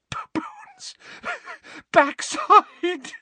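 whispered then laughing, gasping; choking with laughter. A narrator's reading. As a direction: squeeze it out between fits of laughter. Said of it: "she choked".

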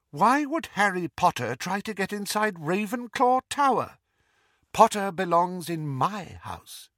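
high, indignant challenge; affronted stern teacher; disbelief and anger. A narrator's reading. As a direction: question it incredulously, with a little pride. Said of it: "Beneath the disbelief and anger".

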